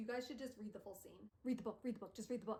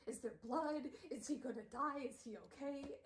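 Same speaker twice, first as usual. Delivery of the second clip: raised, mock-anxious questions; stressed worrying; 4 semitones higher than usual. Viewer fretting each time Jem coughs, mock-stressed. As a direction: fret over each question, lightly acted. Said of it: "it's, like, so stressful".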